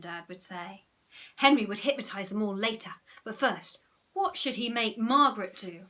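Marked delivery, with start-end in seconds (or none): none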